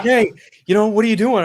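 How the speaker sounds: high, loud dad imitation. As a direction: pitch it up to play dad. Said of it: "He's like".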